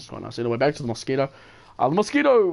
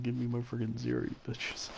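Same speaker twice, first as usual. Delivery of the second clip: muttered aside; 12 dB quieter than usual. Retold or imitated. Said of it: "Kinda sounds like I'm saying".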